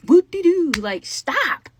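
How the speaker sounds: mock them with fake cheer; sarcastic whoop. Real, not acted. Sarcastic jab at commenters.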